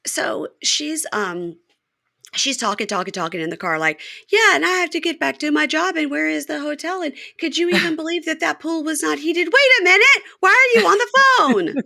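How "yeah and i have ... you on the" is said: a higher mock Joy chatter; voicing Joy. Podcaster mimicking Joy's car rant, higher. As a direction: rattle on like a self-absorbed girlfriend.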